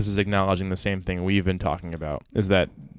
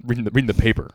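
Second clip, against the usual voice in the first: mock proud dad; 5 dB louder, faster than usual. Dad impression.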